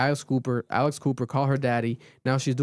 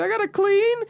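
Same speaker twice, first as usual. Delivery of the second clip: very high, louder whiny wail; mock wail; 3 dB louder than usual. Mocking a crying reality star, high whiny wail. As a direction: squeal it like a pampered girl losing it. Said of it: "crying, going crazy".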